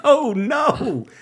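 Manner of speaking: a playful spooked gasp of words; dismayed surprise. Retold or imitated. Told the manager heard nothing, high spooked dismay.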